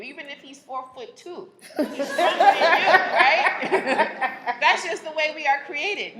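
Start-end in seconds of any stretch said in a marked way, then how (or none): 1.8-2.9 "He's stronger than you" much louder and higher; emphatic laughing repeat. Shown as a demonstration. 2.9-6.2 much louder and higher, laughing; loud laughing emphasis. Real, not acted. Laughs at own point.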